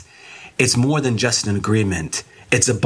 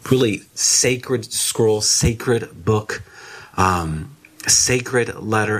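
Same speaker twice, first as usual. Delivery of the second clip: sacred said slow and leaned on each time; slow, deliberate weight on "sacred"; stress on sacred; much slower than usual. Shown as a demonstration. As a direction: draw out "sacred" to make it weigh. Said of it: "and I emphasize the word sacred".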